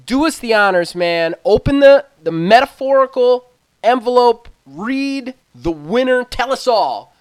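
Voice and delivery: high, loud build-up to the envelope; hyped awards host. Acted. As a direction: pump up the reveal like a gameshow host. Host hypes the winner reveal.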